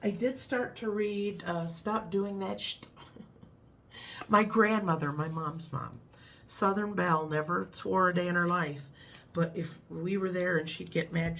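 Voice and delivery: ordinary talk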